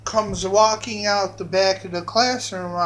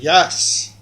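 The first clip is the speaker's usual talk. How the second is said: solemn declaration; 4 semitones lower, 4 dB louder than usual. Retold or imitated. Redone as a declaration of godhood.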